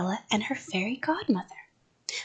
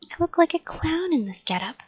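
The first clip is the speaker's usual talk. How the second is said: put-on higher-pitched embarrassed child; 7 semitones higher than usual. A narrator's reading. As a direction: admit it with embarrassment. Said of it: "I said, real embarrassed".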